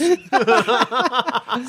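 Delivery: high giggling fit; breaking character giggling. Real, not acted. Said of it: "I can't keep a straight face".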